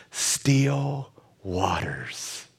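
very slow, drawn-out whisper; hushed whisper. Acted. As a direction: let the words settle into stillness. Hushes the verse to illustrate stillness.

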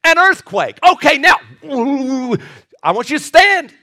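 high, loud pulpit delivery; full-force preaching. Acted. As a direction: preach the storm at full pitch.

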